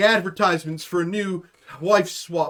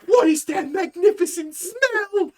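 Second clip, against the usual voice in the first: put-on much higher, slow, awed child voice; mock delighted kid; 12 semitones higher, slower than usual. Imitating the twelve-year-old smelling bacon, high and amazed. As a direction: gasp it out like it's Christmas morning. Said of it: "looks like it's Christmas".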